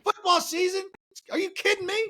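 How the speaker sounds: a high incredulous yelp at the very idea; mock disbelief. Turning down a football-season invite in high mock disbelief. Imitating his usual reaction to invitations.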